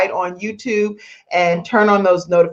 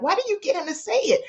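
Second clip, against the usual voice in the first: high whiny complaint; 13 semitones higher, 7 dB quieter, faster than usual. Retold or imitated. Imitating Mackenzie's complaint.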